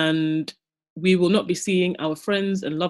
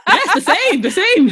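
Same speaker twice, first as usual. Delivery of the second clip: giggling, high and loud; laughing; 14 semitones higher, 8 dB louder, slower than usual. Real, not acted. Host admits her mind was in the gutter, laughing. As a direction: laugh out the confession.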